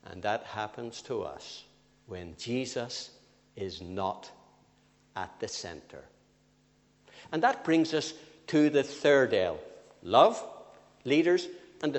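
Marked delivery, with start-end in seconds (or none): 0.1-6.0 quiet and solemn. Real, not acted. Solemn point about Jesus.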